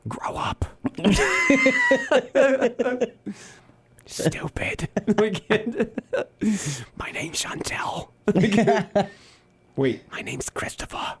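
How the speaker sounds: breathy whisper of a mock insult; whispered taunt.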